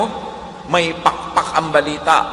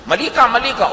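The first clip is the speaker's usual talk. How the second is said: higher-pitched mock accusation; acted accusation; 10 semitones higher, 3 dB louder than usual. Acting out someone accusing the listener, high-pitched accusation. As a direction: throw the blame in a high voice.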